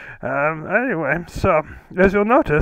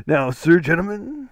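put-on host voice. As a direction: slip into a mock formal voice on the word. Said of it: "why does my voice always change".

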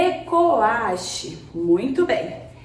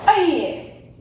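kiai shout; 3 semitones higher than usual. Shown as a demonstration. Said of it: "fazer o grito".

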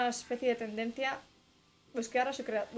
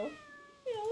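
meow; 13 semitones higher, 6 dB quieter than usual. Pretend. Imitating a cat.

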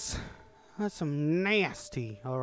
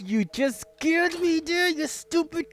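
shaken scolding; 9 semitones higher than usual. Real, not acted. After being scared by friend.

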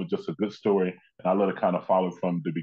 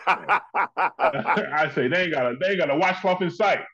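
higher-pitched, words breaking into laughter; laughing tease; 7 semitones higher than usual. Real, not acted. Guest ribbing host about his shower scenes, laughing. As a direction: crack up while teasing.

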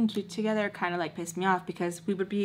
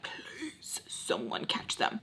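whispered prayer; 8 semitones lower, 11 dB quieter, much slower than usual. Retold or imitated. Secret prayer for them caught.

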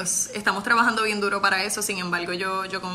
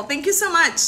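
higher, louder, beaming thank-you; excited thanks; 8 semitones higher, 4 dB louder than usual. Real, not acted. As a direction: gush your thanks to the chat. Thanking viewers for compliments.

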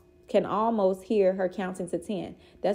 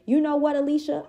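higher and louder, a pointed confrontational jab; sharp challenge; 8 semitones higher, 4 dB louder than usual. A narrator's reading. Grandmother squaring up to the mother, sharp and loud. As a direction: throw the name at her like a dare.

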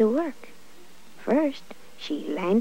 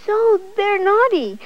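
put-on upset child; 5 semitones higher, 6 dB louder than usual. A narrator's reading. Boy complains upset.

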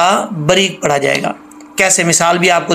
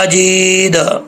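Majeed said very slowly; careful, slow Majeed; slow recitation; much slower than usual. Shown as a demonstration. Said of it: "फिर ये सही तरीके से पढ़ा जाएगा".

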